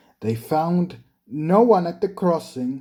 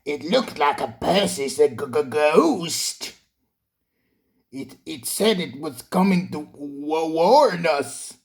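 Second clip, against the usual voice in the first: stammering scared engine; shaky voice. A narrator's reading. Toby telling Thomas about the ghost, shaky stammer. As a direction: stutter over the word ghost. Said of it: "said Toby in a shaky voice".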